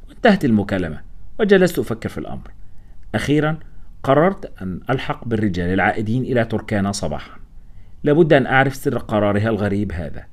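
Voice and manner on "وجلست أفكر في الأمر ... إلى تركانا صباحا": usual speaking voice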